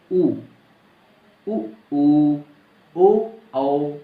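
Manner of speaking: उ, ऊ, ओ, औ said with lips rounded into a circle; rounded vowel demo. Shown as a demonstration. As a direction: model the rounded vowels ऊ and औ clearly. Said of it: "तो क्या हो रहा है".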